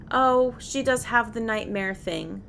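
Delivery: normal talking voice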